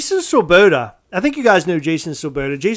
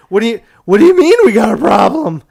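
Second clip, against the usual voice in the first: higher, louder disbelieving question; retold alarm; 5 semitones higher, 8 dB louder than usual. Host re-enacts hearing of a problem, alarmed disbelief. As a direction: re-enact rising panic at bad news.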